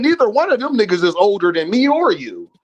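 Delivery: ordinary delivery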